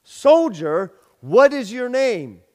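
loud, high barked demand; a general shouting down a soldier; commanding shout. Retold or imitated. General demands the name again.

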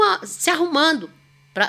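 ordinary talk

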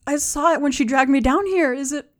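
high, loud, worried questioning; alarmed plea for news; anxious captive woman. Acted. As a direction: ask urgently, fearing the answer. Captive urgently asks after the child.

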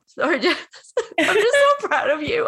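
high, laughing through tears of pride; laugh-cry it, high and bursting; real laughter breaking up the words. Real, not acted.

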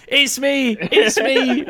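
high-pitched mock shout; imitated shouting. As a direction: cry out as a panicked brother, played for laughs. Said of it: "heard my brother shouting".

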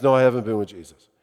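low, hurried mock denial; Peter's quick denial. Peter denies knowing Jesus to the young woman, quick low denial. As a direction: brush off the accusation, low and fast. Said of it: "this young woman who asks".

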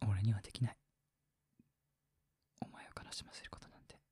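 whispered, mock-romantic heartthrob vow; ikebo line. Shown as a demonstration. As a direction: breathe a hushed romantic vow. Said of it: "なんてな".

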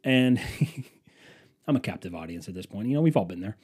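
ordinary talk

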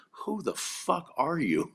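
high, drawn-out mock disbelief; incredulous, stretched-out retold question; amazed demand. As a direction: imitate an astonished friend, pitch way up, slowly. Friend amazed at his treatment.

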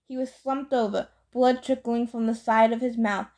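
ordinary delivery

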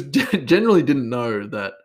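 usual speaking voice

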